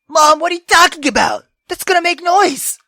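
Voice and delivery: high, loud exasperated reply; loud protest. Real, not acted. Gamer protesting loudly to her mom. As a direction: call it back in disbelief.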